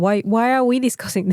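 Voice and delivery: usual speaking voice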